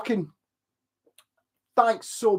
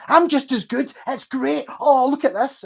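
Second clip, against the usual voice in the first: much higher sneering imitation of a smug guy; mocking show-off; 10 semitones higher, 4 dB louder than usual. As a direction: parody him preening over his own guitars.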